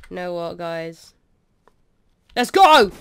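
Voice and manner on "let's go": loud, raised battle call; charge call. Pretend. Player sending guys into battle, shouted charge call. Launching the attack.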